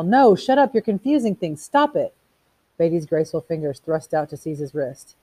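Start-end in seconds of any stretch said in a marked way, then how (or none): 0.0-2.1 high, loud burst of protest; desperate yell. A narrator's reading. Montag wanting to silence Beatty, desperately yelling. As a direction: cry out to make it stop. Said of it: "He wanted to yell".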